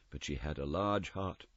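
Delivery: soft, hushed spirit voice; a gentle ghost speaking low; ghost's voice. Acted. The ghost telling Scrooge of his sister, softly.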